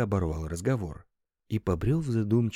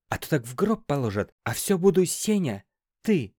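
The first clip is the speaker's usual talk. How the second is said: grumbling; 7 semitones higher, 4 dB louder than usual. A narrator's reading. Boss grumbling about how staff address him, irritated. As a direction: boss complaining, louder and higher by the end. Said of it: "ворчал Семён".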